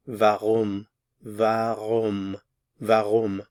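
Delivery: warum said as varoom, W as V; German warum. Shown as a demonstration. Teacher drills German warum for learners, V sound. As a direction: model warum three times, V at the start. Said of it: "notice the pronunciation of the W".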